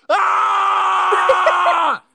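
sudden high yelp; startled shout. Retold or imitated. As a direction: shriek it out in surprise. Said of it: "I was like".

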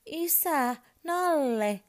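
high, loud demanding call; child calling out. A narrator's reading. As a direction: yell from bed for dad and teddy. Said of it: "hän huutaa".

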